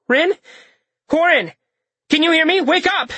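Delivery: urgent high shout to wake him; calling out. Acted. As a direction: call his name loud and worried. Calling to an unconscious friend.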